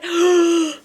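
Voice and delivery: sharp, high gasp of delight; real, thrilled intake of breath; amazed gasp. Real, not acted. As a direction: gasp hard in astonishment. Stunned by gift reveal.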